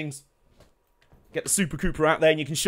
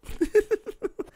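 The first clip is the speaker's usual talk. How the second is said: high, quieter giggle; 4 dB quieter than usual. Real, not acted. Streamer amused by his shirt's small writing, high giggle.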